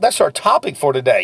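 ordinary talk, nothing marked

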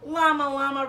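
lilting picture-book read-aloud, bigger on each Llama Llama; reading to children. Acted. Teacher reading a rhyming bedtime book to her class. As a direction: read it like a bedtime story for little kids.

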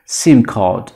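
"سیم کارت" with a d at the end; English pronunciation. Shown as a demonstration. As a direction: voice the last sound of "سیم کارت" as d. (تلفظ انگلیسی «سیم کارت» با صدای دال)

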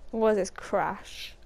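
ordinary talk

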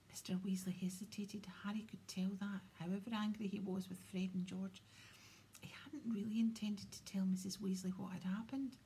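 usual speaking voice

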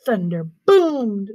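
usual speaking voice

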